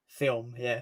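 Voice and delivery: film said with the L silent, like fim; film with no L sound. Shown as a demonstration. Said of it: "Silent L".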